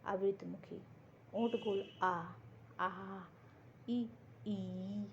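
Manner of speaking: teacher sounding out आ and ई with spread lips; demonstrated vowels. Shown as a demonstration. Teacher showing students the vowels, spread lips. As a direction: say आ, ई clearly with lips spread wide. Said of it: "आप इसका उच्चारण करेंगे तो देखिए".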